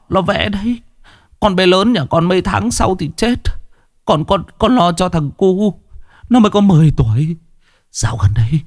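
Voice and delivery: high, frantic fearful pleading; a father in panic begging for his son; panicked. A narrator's reading. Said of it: "ánh mắt hoảng hốt".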